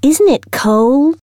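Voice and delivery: put-on slow, high sugary southern accent question; a sweet southern accent, stretched out. A narrator's reading. Science teacher chatting to Miss Meadows, sweet southern accent. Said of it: "in her sweet southern accent".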